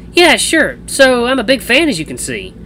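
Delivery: high, bubbly sister voice; eager sports fan. A narrator's reading.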